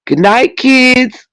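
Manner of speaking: high, sugary family goodnight; Waltons family goodnight. Retold or imitated. Mocking The Waltons family with a high sugary goodnight. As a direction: coo it like a sweet TV family. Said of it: "The Waltons".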